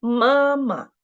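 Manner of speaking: "Mama" with a stressed first syllable and a clipped, light second; neutral tone demo. Shown as a demonstration. Teacher repeating the example for the students, short light ending. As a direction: say "Mama" as the model, with the second "ma" barely touched. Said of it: "we should read it very short and light".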